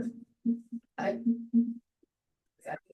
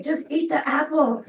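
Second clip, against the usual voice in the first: higher, louder mock-pleading; exasperated plea; 8 semitones higher, 8 dB louder than usual. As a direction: push him, half laughing in disbelief. Voicing herself begging friend.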